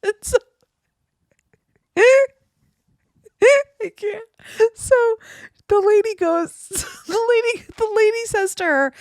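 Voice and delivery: high, squeaky words through giggles; laughing hard. Real, not acted. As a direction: try to keep telling it while cracking up. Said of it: "it just makes me laugh so hard".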